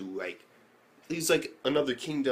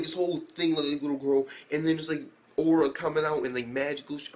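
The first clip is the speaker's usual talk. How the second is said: ordinary delivery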